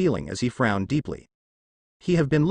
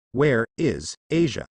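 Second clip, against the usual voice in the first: growl; slower than usual. A narrator's reading. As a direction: growl it slowly, threatening. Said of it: "hoisting him up in air with a growl".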